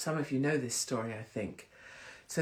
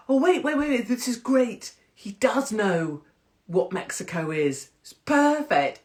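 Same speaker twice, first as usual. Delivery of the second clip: loud, higher, delighted realisation; acted-out eager relief; excited relief; 7 semitones higher, 6 dB louder than usual. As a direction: burst in as if it all suddenly makes sense. Acting out her relieved reaction.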